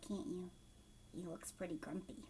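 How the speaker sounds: ordinary delivery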